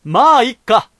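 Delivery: a loud, strong cry. Shown as a demonstration. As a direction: belt it out to vent. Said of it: "ぐらいの音量じゃないと気が済まない".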